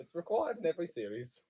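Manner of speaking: normal talking voice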